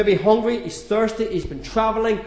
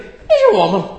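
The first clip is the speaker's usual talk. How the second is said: a louder, mocking jab in another voice; sister's sneer; 9 dB louder than usual. Mary silently mocking her busy sister Martha, loud and snide. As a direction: poke fun at the busy sister. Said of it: "she's sort of thinking".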